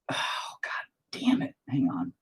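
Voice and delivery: slow, breathless whispered oh God damn it; whispered curse. Real, not acted. Painter cursing a slip to himself, whispered. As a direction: curse under the breath at the mistake.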